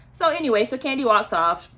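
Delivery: normal talking voice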